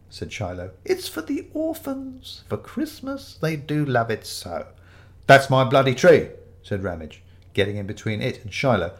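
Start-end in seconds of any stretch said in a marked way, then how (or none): 0.8-4.7 high, slow pious plea; quavery elderly vicar. Acted. Fake vicar pleads for the orphans, high, slow and quavery. 5.3-6.3 loud, indignant claim; angry protest. Acted. Ramage gets between Shiloh and the tree, loud and angry.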